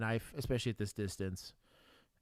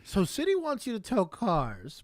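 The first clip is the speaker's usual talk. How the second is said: mock dilemma voice; 10 semitones higher, 8 dB louder, slower than usual.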